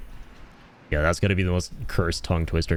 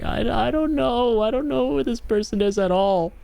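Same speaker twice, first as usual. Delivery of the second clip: confused friend. Retold or imitated. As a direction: imitate a baffled friend, pitched way up. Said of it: "but he's confused".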